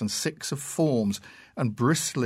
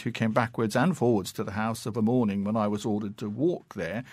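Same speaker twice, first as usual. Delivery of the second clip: plain reading voice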